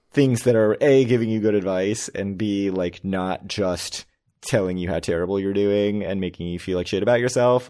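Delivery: ordinary talk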